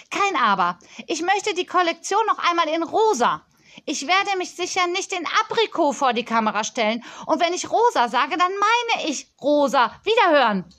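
put-on a pampered child scolding a supplier; spoilt girl on phone.

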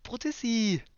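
high, sugary lovers' teasing; mock lovey couple. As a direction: play lovers who can't hang up. Mock couple hang-up game.

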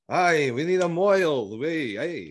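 high, drawn-out mock Yiddish accent; Mel Brooks. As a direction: put on an old Yiddish man's accent, slow and high. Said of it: "He's like".

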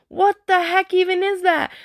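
high-pitched mock indignation, rising sharply; imitated offended kid. Mom imitating her son hearing about his Adam's apple, offended. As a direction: squeal it like an affronted kid. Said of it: "he was so offended".

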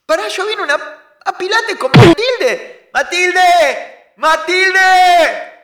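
high shrill panicked pleading; panicked high yelling. Acted. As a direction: plead for mercy while being hit. Being punched, pleading shouts.

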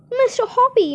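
high, loud excited call up to the balcony; calling up from below, shrill with excitement; excited shout. Acted. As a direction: shout his name, thrilled. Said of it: "she was shouting".

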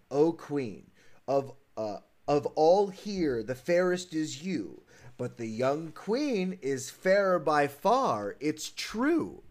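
slow, measured oracle; magic mirror voice. Acted. Glass answering the queen who is fairest, slow magic mirror voice. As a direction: intone it slowly as an enchanted mirror. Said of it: "I forgot my mirror voice".

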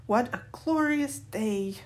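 slow, lifted, savouring delivery; breathing deeply. A narrator's reading. Mom taking in the fine day, slow contented exclamation. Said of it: "breathing deeply".

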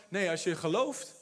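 gentle reassurance. Acted. Jesus gently answering sister.

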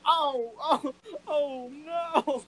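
high, broken oh no; a fan overwhelmed by the song; crying out no. Retold or imitated. Fan hit hard by the orphanage line, high breaking no. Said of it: "And I'm just like".

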